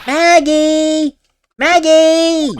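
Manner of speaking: calling out. Acted. Maya calling out for Maggie.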